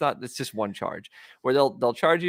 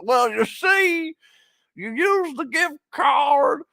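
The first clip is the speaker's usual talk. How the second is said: much higher, very slow drawling Cosby voice; Bill Cosby impression; 4 dB louder, much slower than usual. Streamer ends a tip with a slow high Cosby drawl. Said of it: "a Bill Cosby impression ... Southern drawl".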